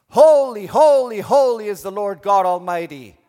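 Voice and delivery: loud, high angelic proclamation; calling out. Acted. Angels calling to one another in the throne room, calling out. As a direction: cry it out at full voice. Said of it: "they are calling out to one another".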